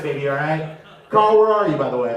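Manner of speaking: usual speaking voice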